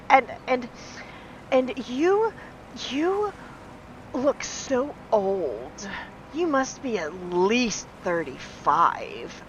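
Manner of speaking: halting lead-in, then a slow, dragged-out sneer; mean girl taunt. Retold or imitated. Imitating the patient who calls her old, slow sneer. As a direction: stretch the insult out to sting. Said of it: "And she's like".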